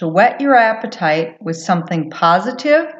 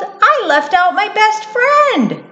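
high, tickled little mouse voice; giggly mouse; 13 semitones higher than usual. A narrator's reading. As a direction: chide yourself merrily as a little mouse. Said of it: "she giggled".

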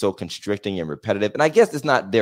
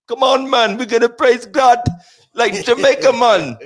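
higher, playful mock Jamaican accent; 10 semitones higher, 3 dB louder than usual. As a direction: ham up a Jamaican accent, laughing at the end. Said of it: "cussing in Jamaican".

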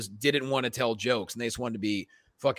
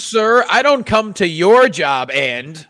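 mock comeback to a heckler; 6 semitones higher, 10 dB louder, slower than usual. Acting out a comic's comeback to a heckler.

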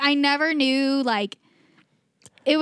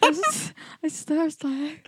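slow, higher mocking copy of partner's voice; imitating silly voice; 4 semitones higher, 3 dB quieter, much slower than usual. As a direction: drag it out in a teasing copy. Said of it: "why was that the voice you chose".

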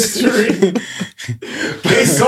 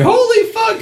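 put-on high yelled holy fuck from the scared kids; kids freaking out. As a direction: shriek it like a frightened kid. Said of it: "They're like".

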